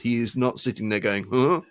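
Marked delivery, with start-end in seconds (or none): 1.3-1.6 exaggerated French grunt, pitched up; caricatured French interjection; mock French accent. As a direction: imitate a Frenchman with a loud, higher "huh". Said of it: "not a trace of a French accent".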